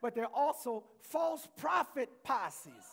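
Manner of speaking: slow, loud, high pulpit shout; drawn-out preacher's shout; preaching. Acted. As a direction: hammer each word out to the congregation. Preaches at full force.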